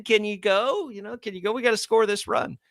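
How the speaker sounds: high-pitched, imploring coach imitation; mock pleading in a high voice; retold urging. Coach retelling urging injured runner.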